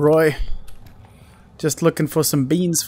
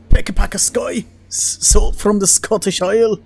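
put-on terrible Scottish accent; 3 semitones higher than usual. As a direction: do a bad, broad Scottish accent. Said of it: "terrible Scottish accent coming from me".

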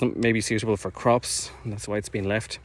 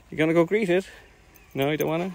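high, sing-song voice for a pet; talking to a dog; 8 semitones higher, 3 dB louder, faster than usual. Real, not acted. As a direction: coo at the dog playfully.

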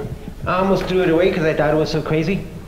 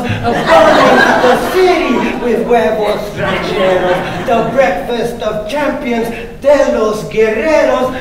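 louder, higher rhythmic recital than the chat; playful spoken-word poem riding each rhyme; performance poetry; 8 semitones higher, 5 dB louder, slower than usual. Acted. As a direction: bounce the rhymes to the crowd. Poet performing a rhyming poem.

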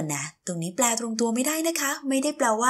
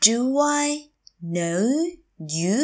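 Do, I, know, you, each separate; slow teacher model of "Do I know you"; word by word; 2 semitones higher, much slower than usual. Shown as a demonstration. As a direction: say "Do I know you" with a pause after every word. Teacher repeats phrase word by word.

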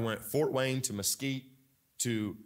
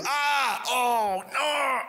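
mock fan groan; 10 semitones higher, much slower than usual. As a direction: wail like your team just fumbled. Fans groaning at a bad play.

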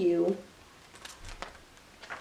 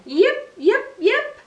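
high, louder yipping fox call; fox yips; 9 semitones higher, 5 dB louder, slower than usual. A narrator's reading. Little fox calling at the barn, high loud yips. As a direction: yip out like a fox pup calling. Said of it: "Little Fox called again".

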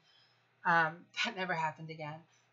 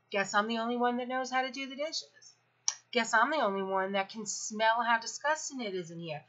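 higher, snide sarcastic jab; 3 semitones higher than usual. Retold or imitated. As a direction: drip sarcasm at the room. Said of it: "that sarcastic assholeness".

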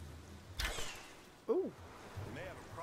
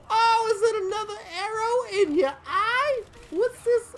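high, gleeful mocking taunt; 11 semitones higher than usual. Real, not acted. Gamer taunting an enemy with an arrow in his eye, high and gleeful. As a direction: tease the enemy with a high, delighted voice.

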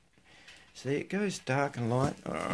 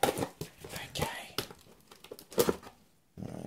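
soft whispered okay; barely voiced whisper; 7 dB quieter, much slower than usual. Real, not acted. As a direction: murmur it to yourself. Quiet aside while opening.

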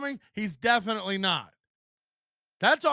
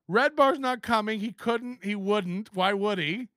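normal talking voice

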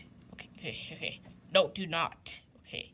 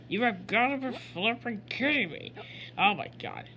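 ordinary talk

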